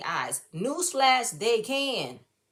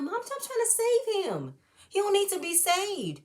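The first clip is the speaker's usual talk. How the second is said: exasperated; 10 semitones higher than usual. Retold or imitated. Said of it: "I'm like".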